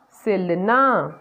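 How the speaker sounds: drawn-out, slow word; slow blending. Shown as a demonstration. Teacher slowly blends syllables for kids, drawn out. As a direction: stretch the word slowly for children.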